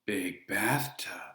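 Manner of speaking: whispered, slow, deadpan; dry whisper. A narrator's reading. Ferryman mildly impressed, dry whisper. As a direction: breathe it drily. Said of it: "Charon looked mildly impressed".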